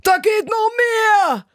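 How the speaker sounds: high, loud heckling shout; shouted heckle. Retold or imitated. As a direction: yell it from the back of the bar. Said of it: "brüllt einer aus dem Hintergrund".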